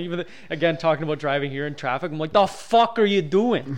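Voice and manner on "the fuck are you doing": slow, louder mob guy snarl; a hint of a road-rage mobster. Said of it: "doing characters in your car".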